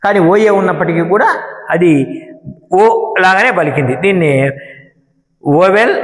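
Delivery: normal talking voice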